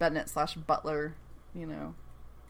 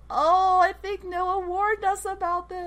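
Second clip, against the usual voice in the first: high, drawn-out sing-song "uh-oh" tone; high, playful told-you-so lilt; mock foreboding; 10 semitones higher than usual. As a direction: tease an ominous callback in a high voice. Sing-song foreboding aside on plot.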